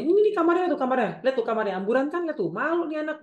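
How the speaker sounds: high, loud showing-off to guests; intrusive mother. Acted. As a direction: act out a mother embarrassing her child. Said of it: "nggak menghormati batasan kita". (ibu heboh mempermalukan anak)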